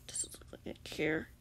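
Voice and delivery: low, soft growl; angry growl. A narrator's reading. The captor dismisses Grian's plea, growling low. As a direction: growl it under the breath. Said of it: "the man growled angrily".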